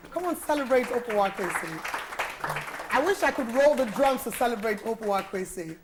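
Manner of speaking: hype shout. Real, not acted. Host hyping up the guest.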